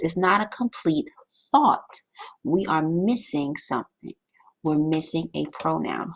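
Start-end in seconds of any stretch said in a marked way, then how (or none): none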